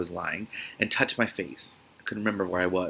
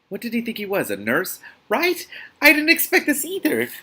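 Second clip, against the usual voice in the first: excited; 13 semitones higher, 6 dB louder than usual. Real, not acted. Reader reacting to the book.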